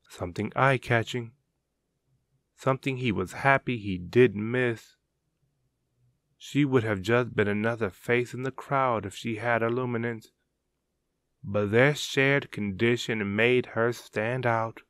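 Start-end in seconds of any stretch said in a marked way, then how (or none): none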